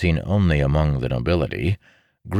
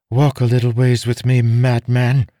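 high, louder imperious order; firm female voice, giving an order; commanding noblewoman; 8 semitones higher, 6 dB louder than usual. A narrator's reading. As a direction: command him as his superior. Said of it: "she commanded".

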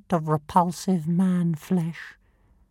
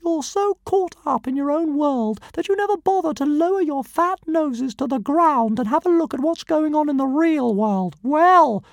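much higher and fast, rattling off grand titles; fast pompous titles; 8 semitones higher, 5 dB louder, faster than usual. Acted. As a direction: reel off your titles proudly at speed. Dragon lecturing Hiccup, high fast.

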